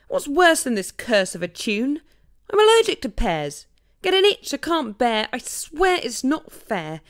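indignant. Acted. Partridge to the animals about pears, indignant and loud. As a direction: vent the unfairness. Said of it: "added indignantly".

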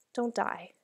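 soft, tearful plea; quiet, helpless pleading. A narrator's reading. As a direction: beg under your breath. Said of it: "I couldn't hold the words back".

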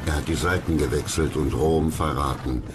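ordinary talk

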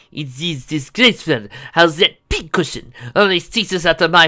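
pompous French captain; French accent. Acted. Antoine's French voice, indignant.

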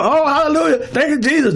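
high-pitched mock rapture; emotional worshipper. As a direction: imitate someone overcome in church. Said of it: "That's people that's emotional".

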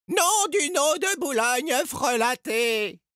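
high, drawn-out wail of frustration; lamenting. A narrator's reading. Edgar curses his own backfired spell, wailing high. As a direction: moan the curse, stretching it out. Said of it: "se lamenta Edgar".

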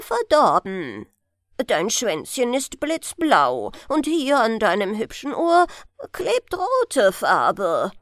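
put-on loud, scolding old grandfather. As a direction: tell the little one off loudly.

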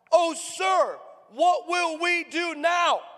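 high, drawn-out panicked wail; freaking-out servant. Acted. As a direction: cry out in hammed-up fear. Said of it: "servant is freaking out".

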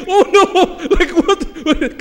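mock panic in a very high voice; freaking out. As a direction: imitate someone caught out and panicking, laughing through it. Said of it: "'Cause he freaking out".

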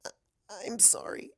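whispered, broken sorry; sobbing whisper; choked out between sobs. A narrator's reading. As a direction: choke it out through tears, whispered. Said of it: "choke out between sobs".

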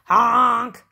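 big loud truck horn; yelled honk. A narrator's reading. Dump truck barges up the road, yelled honk. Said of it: "yelled a dump truck".

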